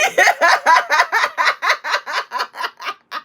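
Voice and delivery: high, long laughter. Real, not acted. Laughing at the loudspeaker bit.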